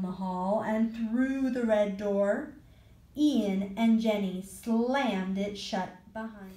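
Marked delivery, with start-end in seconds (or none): none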